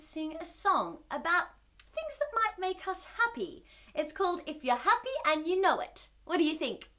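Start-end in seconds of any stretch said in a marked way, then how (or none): none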